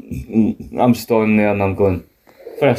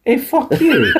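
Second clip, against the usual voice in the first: high, laughing fake offence; laughing mock outrage; 13 semitones higher than usual. Real, not acted. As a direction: squeal it through a laugh.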